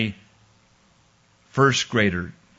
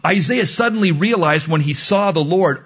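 ordinary talk, nothing marked

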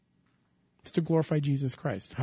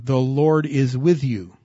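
The Lord is with you, slow with weight on with; slow emphatic repeat; 5 dB louder, slower than usual. Shown as a demonstration. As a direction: lean on the word with, slowly. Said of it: "Please don't miss that term".

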